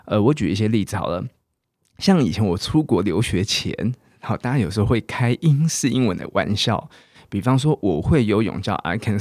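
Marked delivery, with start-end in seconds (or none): none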